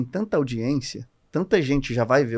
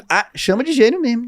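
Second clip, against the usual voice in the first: much higher, mocking voice of the public; public's voice; 11 semitones higher, 6 dB louder than usual. Audience cheering him on, mock high voice. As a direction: mimic the crowd, playful and high. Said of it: "o público".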